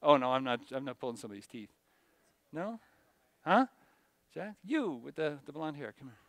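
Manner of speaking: usual speaking voice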